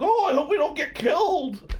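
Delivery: mock scared. The dates frightened on the hayride, high mock fear.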